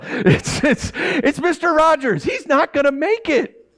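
high, slow, hammed-up wail of despair; mock crying. Joking to the congregation about Mister Rogers, in a high mock sob. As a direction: pretend to sob that he won't make it.

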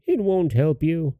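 high witch voice, dismissive; old hag. A narrator's reading. Old hag dismissing the girl's plea, high voice. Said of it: "said the old hag".

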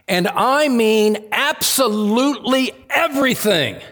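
"absolutely everything" pitched high and punched word by word; emphatic stress. Real, not acted. Preacher explaining "In everything" to congregation, stressing "absolutely everything".

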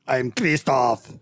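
put-on slow German accent, Christoph with a long o; drawn-out exotic German accent. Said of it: "Then you sound like exotic German".

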